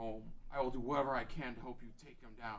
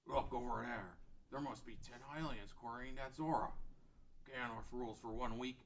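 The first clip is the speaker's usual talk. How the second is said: normal talking voice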